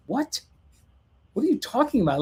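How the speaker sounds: high-pitched, puzzled "what?"; baffled reply. Retold or imitated. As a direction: act out being thrown by the news. Baffled reply to frantic client.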